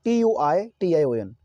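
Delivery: ordinary talk, nothing marked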